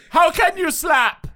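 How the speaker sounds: high, loud, slow alien admiral shout; mock Ackbar yell. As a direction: stretch it out like a booming fish admiral. Doing the Ackbar gag.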